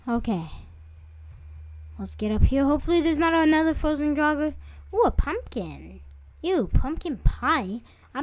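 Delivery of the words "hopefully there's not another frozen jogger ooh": normal talking voice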